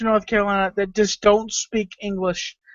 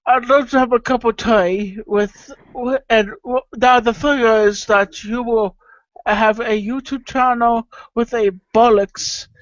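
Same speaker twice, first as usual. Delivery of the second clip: lightly put-on British accent; a hint of a posh British host; mild mock British accent. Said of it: "do a British accent".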